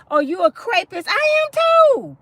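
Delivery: a hammed-up madwoman shouting; playing crazy. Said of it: "Meet their crazy".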